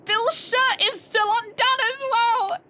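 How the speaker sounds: loud high frantic cry; hysterical yell. Real, not acted. Player shrieking about Bill's open shirt. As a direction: shriek it half laughing.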